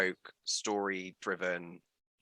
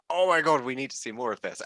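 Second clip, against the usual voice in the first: loud, high burst of retold excitement; excited mock gasping fans; 4 semitones higher, 7 dB louder than usual. Host voicing everyone's reaction to the project, excitedly. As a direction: imitate a thrilled crowd, starting big then easing.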